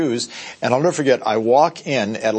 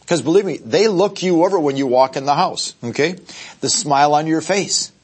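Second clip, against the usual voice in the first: ordinary delivery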